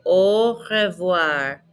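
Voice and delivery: long, stretched syllables; very slow. Shown as a demonstration. Teacher has learners repeat goodbye, very slowly. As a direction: draw it right out for copying. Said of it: "I'm gonna say very slowly".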